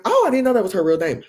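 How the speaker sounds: high-pitched innocent surprise; retold surprise. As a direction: replay a surprised realization. Replaying own surprised remark.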